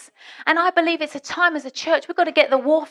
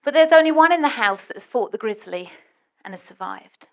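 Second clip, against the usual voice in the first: ordinary talk, nothing marked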